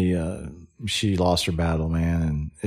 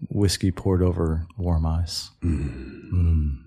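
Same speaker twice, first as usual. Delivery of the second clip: slow, soft, lower mock crooner voice sinking into a whisper; mock sultry radio voice; 4 semitones lower, 5 dB quieter, slower than usual. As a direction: tease him like a velvet late-night DJ. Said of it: "it's Josh Thompson".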